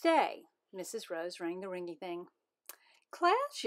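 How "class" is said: teacher calling. Acted.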